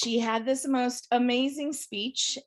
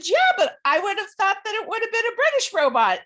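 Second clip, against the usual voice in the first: earnest husband; 11 semitones higher, 6 dB louder, faster than usual. Retold or imitated. Husband explains his logic.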